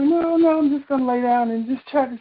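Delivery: high-pitched retold protest. Refusing the older woman's hospital plea, protesting. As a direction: brush off the fussing, insistently.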